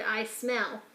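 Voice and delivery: plain reading voice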